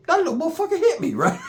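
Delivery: very high-pitched imitation of shock; mock disbelief. Punched man in the mall, high mock disbelief. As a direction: squeak out the victim's amazed protest.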